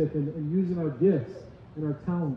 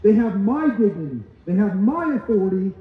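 preaching at full force; 4 semitones higher, 6 dB louder than usual. Acted. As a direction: punch each line louder and higher. Preacher emphatic climax.

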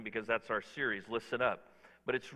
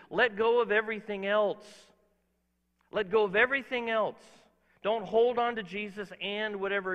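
raised, loud preaching voice; preaching at full force; 5 semitones higher, 6 dB louder than usual. Acted. Pastor exhorting the congregation to let go, loud preaching. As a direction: hammer each point home.

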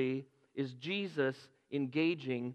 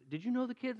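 excited quote; 9 semitones higher than usual. Retold or imitated. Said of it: "she was all excited".

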